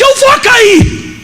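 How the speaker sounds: very high, drawn-out mock wail; panicked cry. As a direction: imitate a woman terrified of falling. Imitates frightened woman being pulled up. (imitando grito aflito, agudo e arrastado)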